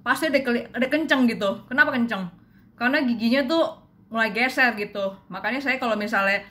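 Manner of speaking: speaking with retainer on teeth; slight lisp from retainer; wearing a retainer. Real, not acted. Seen 0: Retainer fitted at -2; upper retainer worn while pointing at teeth.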